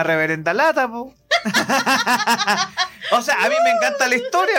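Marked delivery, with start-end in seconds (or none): 1.3-3.1 high, bubbling laugh; burst of laughter. Real, not acted. Laughs at her own joke. 3.1-4.5 high, fast words through laughter; laughing. Real, not acted. Laughing while explaining.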